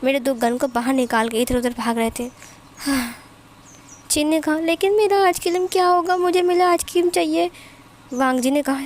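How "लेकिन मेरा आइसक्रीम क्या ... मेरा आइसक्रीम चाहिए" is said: put-on high, loud child's whine; whiny little girl. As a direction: demand it back like a cranky toddler. Child insists on ice cream.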